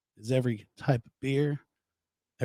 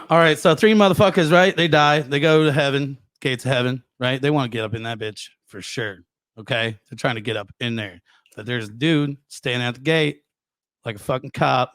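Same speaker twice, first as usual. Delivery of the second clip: louder, punchy joke-selling delivery; 6 dB louder than usual. Real, not acted. Telling the Saint Peter joke to friends, loud and hyped. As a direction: sell it big to the room. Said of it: "So I'm gonna sell it".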